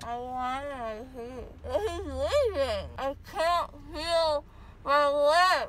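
slow, tearful wailing; crying. Real, not acted. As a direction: sob for your lost teeth. Wants her teeth, crying.

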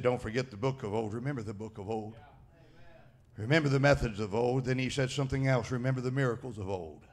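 preacher quietly voicing God's charge; hushed solemn quote. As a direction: drop to a near hush for God's words. Quoting God's words hushed.

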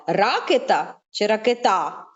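first Ракета stressed on Ра, then ракета on ке; stress contrast. Shown as a demonstration. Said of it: "На яку А?". (порівняння наголосу Ракета і ракета)

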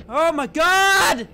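high, loud, stretched-out cry; alarmed yell. Real, not acted. Player startled by the clown, alarmed yell. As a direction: yell out in shock. Sudden scare in game.

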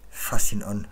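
breathy, forceful plea; lightly acted breathy urging; breathed plea. A narrator's reading. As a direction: breathe the request out urgently. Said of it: "hauchte er".

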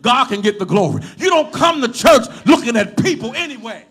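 loud, emphatic sermon shout; full-force preaching. Real, not acted. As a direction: preach it hard to the whole church.

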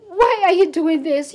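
ordinary talk, nothing marked

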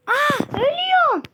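very high, loud panic; panicked shriek. Real, not acted. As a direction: squeal in sudden panic. Dying in game. (panik içinde ciyaklama)

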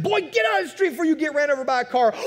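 high, loud, fast warning shout; parent yelling. Pretend. Parent shouting at a boy playing in the street, loud and fast. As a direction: yell at a kid in the road.

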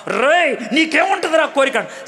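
high, rough "రేయ్, నీకేముంటుందిరా కోరిక?" with scorn; rude jailer. Shown as a demonstration. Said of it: "ఎందుకలా మాట్లాడుతాం?".

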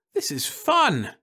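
high, slow gleeful cry; Florence's voice. A narrator's reading. Wife delighting in her magic gifts, high and gleeful. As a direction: savour the fun in a high voice.

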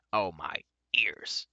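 slow pained whisper. A narrator's reading. Dwarf holding his aching ears, slow whisper. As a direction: breathe it out in pain.

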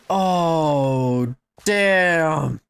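ordinary talk

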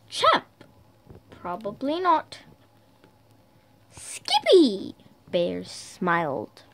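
storybook read with lightly voiced Dog and Bear; reading to children. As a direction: play the two friends gently for kids.

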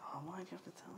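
whispering. Real, not acted. Secret spoiled at table.

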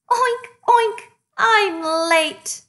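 high, loud mock-crying oinks; weeping piggy. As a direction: wail it like a tearful little pig. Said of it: "poor Piggy wept".

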